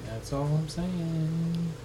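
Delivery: high, very slow, stretched words; drawn-out singsong. Pretend. Host needling the co-host about stealing, teasing drawl. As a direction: tease it out, stretching the last word. Repeats own line in singsong.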